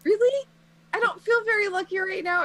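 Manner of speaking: high, loud-edged disbelief; incredulous, sorry-for-herself student; doubtful hurt student. Acted. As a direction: protest in a high girlish voice. Injured Raven doubts she is lucky.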